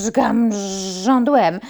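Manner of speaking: loud buzzing threat; sting-chase threat; bee threat. Acted.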